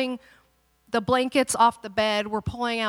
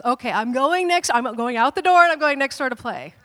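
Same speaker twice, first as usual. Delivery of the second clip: put-on loud, higher child announcement; over-careful child; 3 semitones higher, 8 dB louder than usual. Daughter making sure parents know, loud and careful. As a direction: announce each step like an eager child.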